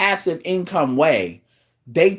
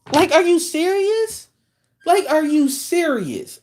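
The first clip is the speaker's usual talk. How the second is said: incredulous; 9 semitones higher than usual. Real, not acted. Disbelief at woman's complaint.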